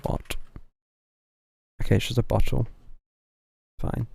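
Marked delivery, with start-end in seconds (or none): none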